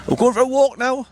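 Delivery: high, excited pet voice; real doggy talk, high and eager. Real, not acted. Telling his dog about the walk.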